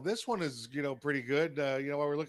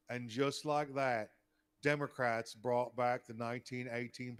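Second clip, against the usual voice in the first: put-on Forrest Gump accent. Co-host reads a political meme on request, Forrest Gump accent. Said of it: "do it in your best Forrest Gump accent".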